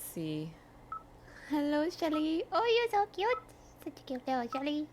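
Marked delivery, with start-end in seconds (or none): 1.5-4.9 high-pitched pet-talk voice; cutesy cooing. Real, not acted. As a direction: coo adoringly at a tiny pet. Cooing at a cute pet turtle.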